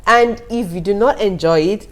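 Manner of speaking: ordinary talk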